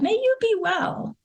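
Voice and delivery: a lifted, gentle wish of well-being; kind blessing. Pretend. Wishing the strangers well.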